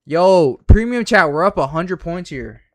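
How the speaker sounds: loud, high hype call; excited shout. Real, not acted. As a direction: shout it out, thrilled. Calling out gains excitedly.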